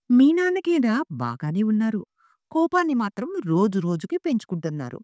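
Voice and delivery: high-pitched, aggrieved sing-out; exasperated complaint. A narrator's reading. Mother complaining to her son about his father's temper, exasperated. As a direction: air a grievance with swooping pitch.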